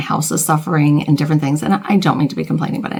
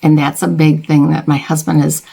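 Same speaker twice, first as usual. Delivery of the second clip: ordinary talk, nothing marked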